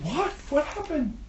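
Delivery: high, soft startled question; surprised. Acted. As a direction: gasp out the question in disbelief. Fish shocked by sad news.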